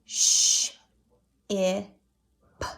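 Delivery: ship tapped as Sh, I, p; tapping sounds. Shown as a demonstration. Teacher taps out the sounds of ship. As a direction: tap out Sh, I, p. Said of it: "Now tap it".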